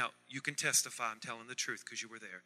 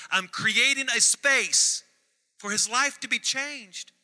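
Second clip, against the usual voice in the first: preaching peak; 8 semitones higher, 9 dB louder, slower than usual. Real, not acted.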